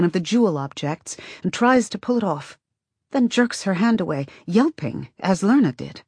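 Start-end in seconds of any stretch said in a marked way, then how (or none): none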